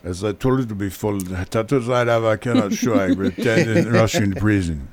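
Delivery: mock Russian prison-talk accent; joke Russian accent. Host joking to radio listeners, mock Russian accent. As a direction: play a lightly done Russian tough guy. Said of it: "that is not my real accent".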